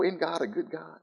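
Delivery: usual speaking voice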